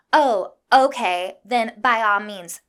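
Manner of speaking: loud, slow, drawn-out mock agreement; sarcastic concession. Retort to a woman cutting in line, loudly sarcastic. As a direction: give in with heavy sarcasm, stretching it out.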